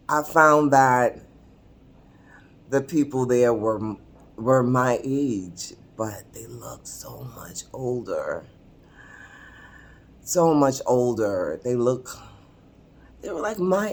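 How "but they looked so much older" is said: hushed, whispered aside; confiding whisper. Real, not acted. Admitting how older her peers looked, confiding whisper. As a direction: drop to a discreet whisper.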